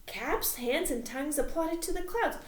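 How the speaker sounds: loud, high and rushed warning; frantic messenger. Acted. Messenger warning the king of the mob, loud and frantic. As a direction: burst in shouting the alarm.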